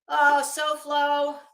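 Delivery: normal talking voice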